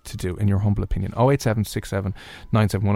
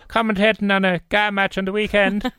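high-pitched Cork accent; Cork match commentator; 8 semitones higher than usual. Shown as a demonstration. Host likening Cork to match commentary, high Cork accent. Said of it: "as if you're commentating".

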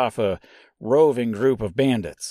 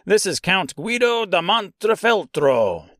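"Count Guido da Montefeltro" in a hammed-up Italian accent, pitched up; weird Italian accent; 5 semitones higher than usual. Shown as a demonstration. Host introducing the count in a silly Italian accent. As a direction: roll out "Guido da Montefeltro" with a mock Italian lilt. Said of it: "without the weird accent".

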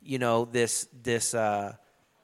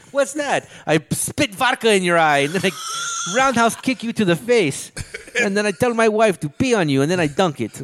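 put-on heavy Russian accent menace; 4 semitones higher, 3 dB louder than usual. Playing a Russian baller.

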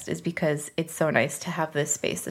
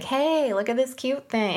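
higher-pitched, playful perky quote; light excitement; 7 semitones higher than usual. Acted. Fat friend showing a friend something cute, lightly excited. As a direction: act out a cute little discovery.

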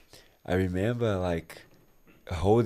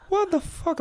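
"What the fuck?" in a much higher, shrieking register; high shocked squeal. Retold or imitated. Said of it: "I'm like".

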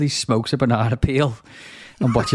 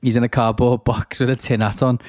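ordinary talk

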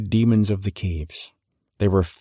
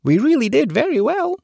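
very high, bright and delighted; pleased fairy voice. Acted. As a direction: squeak it out like a happy fairy. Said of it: "cried the fairy in a pleased voice".

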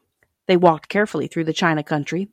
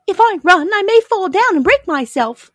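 high, louder little princess voice; china princess; 7 dB louder than usual. A narrator's reading. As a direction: explain anxiously in a high, dainty voice. China princess explains her fear.

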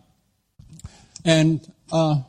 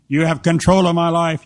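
loud, mock-solemn prayer; mock worship; 2 semitones higher, 5 dB louder than usual. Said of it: "Now say this with me".

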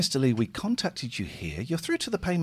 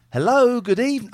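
high, loud lady call-centre greeting; lady's voice; 8 semitones higher, 6 dB louder than usual. Pretend. Scammer greeting a victim on the phone, high lady voice. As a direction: chirp it out like a prim lady. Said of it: "I'm a lady".

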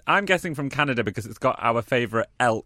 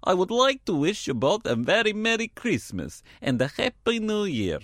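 light mock Russian accent, higher pitched; 4 semitones higher than usual. Co-host reads Montreal fan card aloud in mock Russian accent. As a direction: read it like a jokey Russian. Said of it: "you are gonna be Russian in this".

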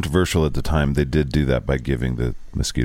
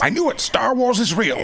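excited squeal. Real, not acted. Excited nerd reaction to bosons.

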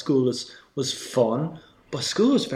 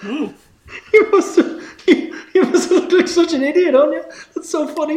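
laughing hard; 7 dB louder than usual. Real, not acted. Bursts out laughing at story.

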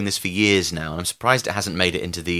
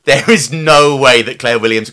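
emphatic; 4 semitones higher, 8 dB louder than usual. Real, not acted. Emphatic insistence.

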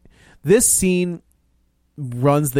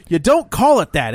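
higher, slow mock-indignant retort; indignant correction; 6 semitones higher than usual. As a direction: drag it out, imitating an offended cop. Retelling a character's indignant correction.

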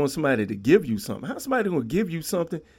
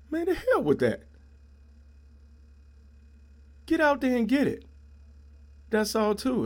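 much higher, fired-up exclamation; fired-up outburst; 9 semitones higher than usual. Real, not acted. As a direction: burst out, urging them to go get it. Snaps out of mock voice.

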